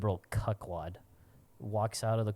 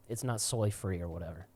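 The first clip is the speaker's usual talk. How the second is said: usual speaking voice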